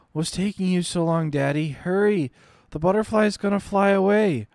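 put-on high little-girl voice, pleading through fever; a delirious child begging her mother's forgiveness; feverish little girl. Dying girl apologizing to Mommy, high pleading child voice.

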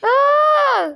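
sudden loud scream. Real, not acted. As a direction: let out a sharp yell. Falls screaming in game. (çığlık)

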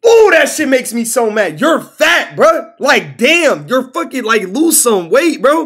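worked-up shouting rant; angry rant. Real, not acted. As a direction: vent the frustration at full volume. Ranting at fat people.

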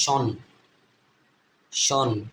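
শন as one syllable "shon"; TION as shon. Shown as a demonstration. Teacher showing learners the ending of national, TION as shon. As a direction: say শন with sh, no t sound. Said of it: "এটা হচ্ছে হবে".

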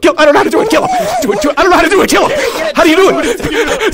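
panicking because he can't pull it off; frantic laughing panic. Real, not acted. Panicked game moment, laughing.

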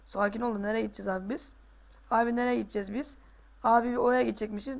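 ordinary delivery

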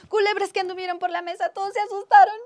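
loud, high, rapid storytelling; excited climax. A narrator's reading. Storyteller telling of snakes on the table, alarmed excitement. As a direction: race through it in mounting alarm.

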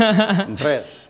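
"Andreas" repeated high and loud; attempted name pronunciation. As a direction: have a playful go at "Andreas", imitating its owner. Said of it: "I won't do it as eloquently".

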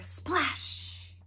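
ordinary delivery, nothing marked

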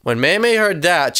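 ordinary delivery

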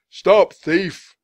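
high, very loud shout; yelling. Acted. Butcher joining the chase, yelling loudly. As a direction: bellow the alarm to the street. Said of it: "the butcher yelled".

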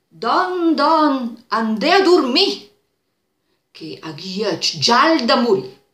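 high, loud call from upstairs; drawn-out call in Lombard dialect. Retold or imitated. Wife calling down from upstairs.

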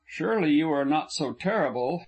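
ordinary delivery, nothing marked

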